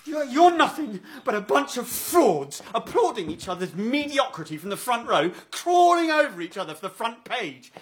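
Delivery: high-pitched tirade with loud bursts; furious rant. Acted. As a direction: rage at her, building to a shout. Son rants at his mother.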